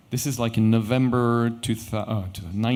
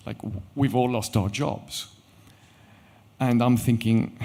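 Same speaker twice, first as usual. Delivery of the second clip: ordinary talk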